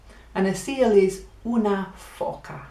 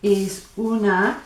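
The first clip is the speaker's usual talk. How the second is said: normal talking voice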